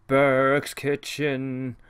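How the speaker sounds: Burke's kitchen stretched very slowly; drawn out slowly. Shown as a demonstration. Host rereads the room name, Burke's kitchen, dragged out slowly. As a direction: linger on each word of Burke's kitchen.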